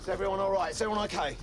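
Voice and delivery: loud, high call to the squad; urgent shout. Acted. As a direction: shout the check over the chaos. Sergeant checking squad after attack.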